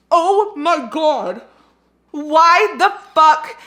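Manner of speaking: loud, high, drawn-out disbelief; shocked outcry. Real, not acted. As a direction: cry out slowly in horror. Shocked by a character's death.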